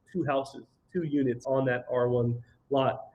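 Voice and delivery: ordinary talk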